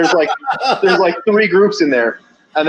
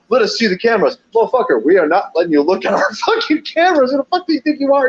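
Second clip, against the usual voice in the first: high, indignant outburst at the guys; snapping back at the hit squad, pitch way up; mock angry retort; 7 semitones higher than usual.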